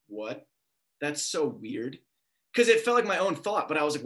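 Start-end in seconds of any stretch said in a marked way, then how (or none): 0.1-2.0 low, soft muttered disbelief; quiet puzzlement. Retold or imitated. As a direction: puzzle it over under your breath. Puzzled reaction to sudden thought.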